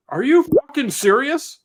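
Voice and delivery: slow, high, loud are you serious; stunned disbelief. Retold or imitated. Friend stunned at the machine, high loud disbelief. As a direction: drag it out in shocked disbelief.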